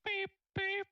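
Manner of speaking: car alarm death scream. Retold or imitated. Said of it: "Yeah, you go like".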